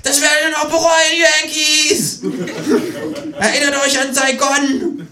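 hoch und laut, fremder Ton; Drohschrei. Acted. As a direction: pathetisch drohen. (pathetischer Drohschrei)

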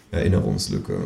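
ordinary delivery, nothing marked

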